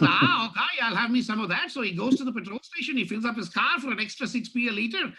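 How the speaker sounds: a light Irish lilt throughout; lightly voiced Irish storyteller; Irish accent joke telling. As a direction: tell the Paddy joke in a mild Irish brogue.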